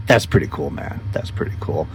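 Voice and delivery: hushed admiration. Real, not acted. Admiring the egg sac.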